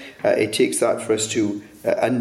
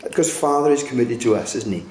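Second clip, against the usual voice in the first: usual speaking voice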